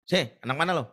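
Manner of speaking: loud tough extortioner challenge; mock thug. Thug stopping a schoolboy, a loud mock tough call. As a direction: swagger like a street thug. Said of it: "setiap ada tukang palak itu".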